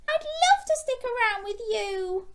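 very high, sing-song character voice; delighted little fish. Acted. Rita accepting a new friend, high acted delight. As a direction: squeal the acceptance in a storybook voice.

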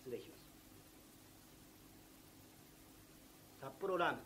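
hushed, dropped low; small voice. Acted. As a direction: confide it shyly, barely audible. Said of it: "小さい声で言ってみたまえ". (恥ずかしそうに低い小声で)

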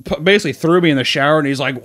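usual speaking voice

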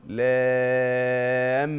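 لام held very long and slow; long madd. Shown as a demonstration. Teacher showing the student the madd. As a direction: draw out the aa of لام. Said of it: "شوف، لام،".

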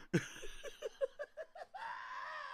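squealing laugh; high shrieking laugh. Real, not acted.

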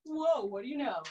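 ordinary delivery

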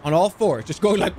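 normal talking voice

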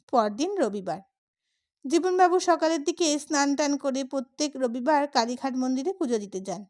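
usual speaking voice

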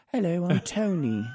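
high-pitched British greeting; Anthony Hopkins. Retold or imitated. Star introducing himself after an outburst, high British accent. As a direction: switch to a sweet, put-on British accent. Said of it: "the best British accent I think I've ever heard".

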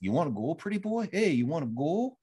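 imitating a Canadian enforcer goading a rookie, Canadian accent. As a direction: taunt him in a hammed-up Canadian accent. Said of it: "And he has that Canadian accent".

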